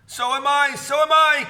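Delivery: high, loud and rising into a shout; Mister Twit crying out that he feels giddy too; frightened cry. A narrator's reading. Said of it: "cried Mister Twit".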